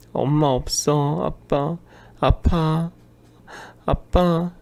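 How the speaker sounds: put-on slow, high whimper of a small girl; crying child. Daughter alone at home cries to father on the phone. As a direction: whimper for daddy, hurt. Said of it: "딸아이가 울면서".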